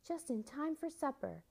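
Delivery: high, happy welcome; joyful cry. A narrator's reading. Old woman welcomes children home to supper, crying out happily. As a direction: keep the pitch lifted and beaming. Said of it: "she cried to the children".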